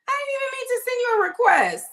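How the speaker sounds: high, loud, fast insistence; emphatic apology. Real, not acted. Host apologizes to V for pulling her in, loud and high. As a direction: blurt it out, really sorry.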